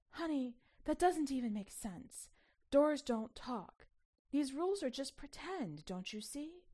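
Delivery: breathless arguing. A narrator's reading.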